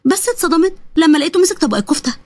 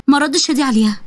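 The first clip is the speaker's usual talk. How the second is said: plain reading voice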